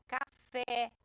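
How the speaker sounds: "café" slowed, with the final "fé" strong; stress on last syllable. Shown as a demonstration. Teacher shows an oxítona example, stressing the end of "café". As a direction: lean on "fé" in "café". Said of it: "Quando essa última sílaba é a mais forte".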